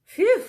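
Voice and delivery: a loud, high, relieved exhale; relieved phew. Acted. Mister Fox safe underground, a loud high relieved phew. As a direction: blow out the relief of a narrow escape.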